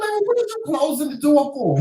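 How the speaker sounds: high-pitched incredulous question; retold challenge to a scared man; mock exasperation. Wife re-enacting asking Joseph about the door, high exasperated tone. Acted quarrel over closed door.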